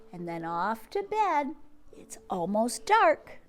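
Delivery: lively sing-song picture book reading; reading to children. Acted. Teacher reading a bedtime book to kids. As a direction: read it out to little ones with bounce.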